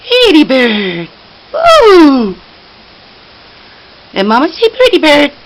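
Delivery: loud, fast, eager calling; excited coaxing. Real, not acted. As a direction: call out to the bird, loud and eager.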